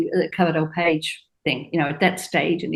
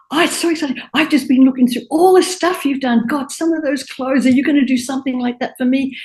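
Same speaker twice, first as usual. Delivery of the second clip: higher, bubbly excited chatter; excited gush; 6 semitones higher than usual. Retold or imitated. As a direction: burst out like she leapt off the couch. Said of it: "She leaps off the couch and she goes".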